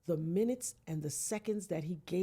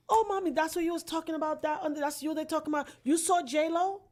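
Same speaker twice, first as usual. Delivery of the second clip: excited nurse; 8 semitones higher, 5 dB louder than usual. Retold or imitated. Nurse gossiping about TV.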